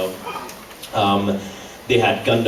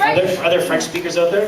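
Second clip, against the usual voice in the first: calling out; 7 semitones higher than usual. Real, not acted. Calling out to the audience.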